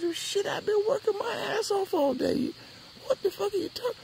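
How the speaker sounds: high, strained outrage; indignant protest. Retold or imitated. Boy defending himself to the girl, high and outraged. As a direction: protest like you were wronged.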